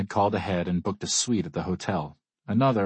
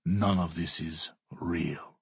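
cold and dismissive; 3 semitones lower, 4 dB quieter, much slower than usual. A narrator's reading. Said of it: "Alexei says".

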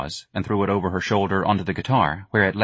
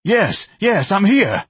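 much higher, louder frantic cry of relief; 11 semitones higher, 6 dB louder, much slower than usual. Acted. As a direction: yelp it out, desperate to be found. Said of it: "Ignatius cried".

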